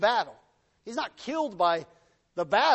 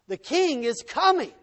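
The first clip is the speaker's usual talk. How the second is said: raised, loud herald's cry; yelling; 7 semitones higher, 5 dB louder than usual. Acted. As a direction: call out the king's arrival. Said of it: "And they're yelling".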